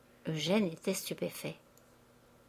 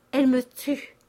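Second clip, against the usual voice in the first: louder, higher cry of pain; stricken exclamation; 5 semitones higher, 7 dB louder than usual. Acted. Father wounded by his daughter's quarrel, stricken. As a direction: push the hurt out in one burst.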